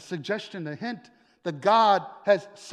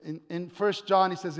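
ordinary delivery

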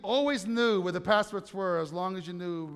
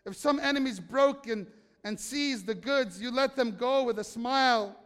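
louder, driving sermon read of Hebrews; preaching a scripture reading; 3 semitones higher, 5 dB louder, slower than usual. Acted. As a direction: preach the passage with conviction, slowing on key lines. Reads Hebrews passage.

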